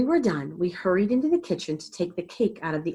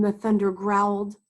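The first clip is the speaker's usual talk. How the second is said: plain reading voice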